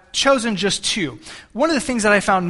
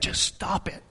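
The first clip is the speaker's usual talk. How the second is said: near-whisper, much softer; hushed retold scolding; 8 dB quieter than usual. Father regretting his words to his son, hushed. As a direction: drop to a breathy hush as you quote yourself.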